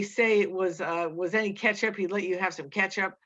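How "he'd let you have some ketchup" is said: ordinary delivery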